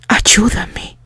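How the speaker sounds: whispered plea for help; a whispered, desperate ayúdame; low plea. Acted. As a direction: breathe it out, begging. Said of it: "pidió en voz baja".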